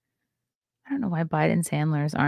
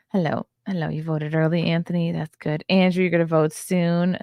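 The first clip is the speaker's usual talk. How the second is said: ordinary delivery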